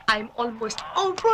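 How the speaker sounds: slow, stretched-out "over"; drawn-out repeat. Acted. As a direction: drag out the last word. (pahabang bigkas)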